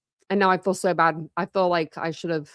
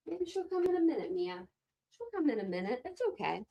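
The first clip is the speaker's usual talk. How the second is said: pet talk voice; 9 semitones higher, 12 dB quieter than usual. Real, not acted. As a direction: coo to the dogs softly. Talking to her dogs.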